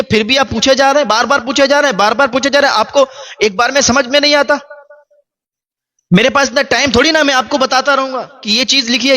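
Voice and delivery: high, fast, impatient outburst; angry scolding. Retold or imitated. Grown son scolding his old father for asking again. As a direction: snap at him, fed up with repeating. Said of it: "تیزی کے ساتھ اونچی آواز میں بولا".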